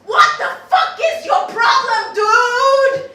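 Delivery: loud, high sung-out yell; furious yell at the keeper; angry shout. Retold or imitated. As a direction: shout it in his face. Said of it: "I'm like".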